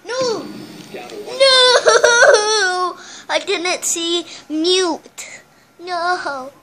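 loud anguished gamer yell; raging gamer. Real, not acted. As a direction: scream no at the screen. Killed in game, raging.

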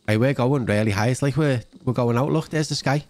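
usual speaking voice

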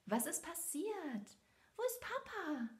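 high, anxious questions; worried. A narrator's reading. Belle asks where her father is, high and worried. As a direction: ask full of worry for her father. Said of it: "fragte Belle voll Sorge".

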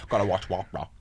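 low Rain Man impression; low, odd-voiced movie impression; Dustin Hoffman impression. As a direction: drop low into a film character's droning repetition. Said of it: "Dustin Hoffman in Rain Man when he goes".

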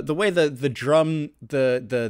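usual speaking voice

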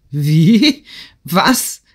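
loud start, then high, slow disbelief; aghast old count; shocked cry. A narrator's reading. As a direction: burst out, then stretch the incredulous question. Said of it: "rief der Graf".

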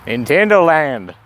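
drawn-out shout of the name; slow loud callout. Real, not acted. Vlogger shouting the sign name.

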